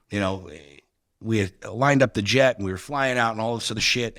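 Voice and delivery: ordinary talk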